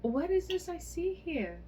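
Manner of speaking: high, loud gasp of amazement; astonished. A narrator's reading. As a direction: shout it out as if you can't believe your eyes. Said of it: "quite astonished".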